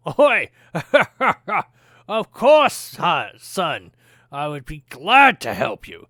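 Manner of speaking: high, loud, jolly dwarf through a laugh; jolly dwarf shopkeeper laughing. Acted. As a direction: chuckle out a hearty welcome. Dwarf laughs, greets customer.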